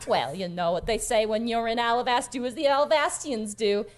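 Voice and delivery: chatty tavern hand shooing guests along; bustling, motherly character voice; folksy Skinny. Acted. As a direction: hurry them up with homely cheer. Plays Skinny hurrying guests along.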